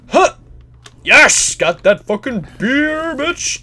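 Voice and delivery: loud, drawn-out victory shout; yelling in triumph at the beer; triumphant yell. Real, not acted. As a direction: crow over the win.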